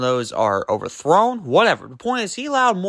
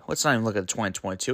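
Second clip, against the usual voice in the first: ordinary delivery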